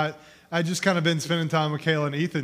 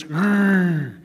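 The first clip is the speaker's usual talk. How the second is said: playful little growl, pushed louder; toddler growl; 3 semitones higher, 7 dB louder than usual. Retold or imitated. Dad imitating his son's growl from the toy box, playful. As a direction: growl like a toddler pretending to be a monster. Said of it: "he goes".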